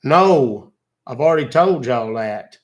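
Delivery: put-on grumpy elderly Southern gentleman, exasperated; cranky old Southern man. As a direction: play a fed-up old country man. Old man persona scolding scammer.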